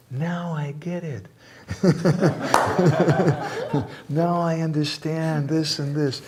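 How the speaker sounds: high, bright-eyed "now I get it" with a laugh; excited realization. Retold or imitated. A wife telling her husband she finally understands, delighted and laughing. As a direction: play the wife's sudden delight at finally understanding.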